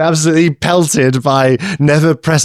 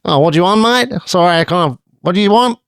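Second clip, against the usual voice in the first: higher, brisk Australian pizza worker; Australian accent; 4 semitones higher than usual. Pretend. Pizza shop worker brushing off a caller, Australian accent. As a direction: play a curt Australian counter guy. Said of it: "I'm gonna do an Australian accent".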